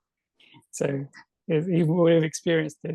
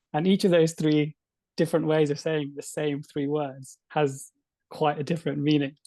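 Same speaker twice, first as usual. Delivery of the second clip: ordinary delivery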